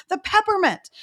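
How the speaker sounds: high-pitched mock child's cry; acted child's voice, pitched well up; distressed little kid. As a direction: imitate an upset little boy insisting. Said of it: "he's got a washcloth in his mouth".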